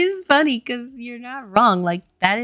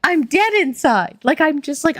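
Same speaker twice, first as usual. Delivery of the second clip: overwhelmed gushing; 8 semitones higher, 9 dB louder than usual. Real, not acted. Gushing over the cute accent.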